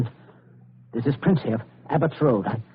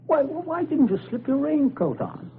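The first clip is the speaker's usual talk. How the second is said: higher, fussy mock concern; fussing host; 10 semitones higher than usual. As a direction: overplay the concern. Father mock concern for guest.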